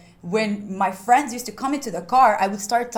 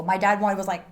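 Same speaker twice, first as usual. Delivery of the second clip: usual speaking voice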